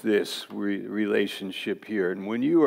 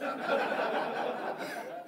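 airy burst of laughter at own joke; breathy laugh; 9 dB quieter than usual. Real, not acted. Pastor laughing at his own devil joke, airy laugh. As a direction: let out a soft, breathy laugh.

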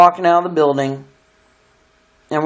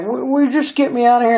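scared; 8 semitones higher than usual. Retold or imitated.

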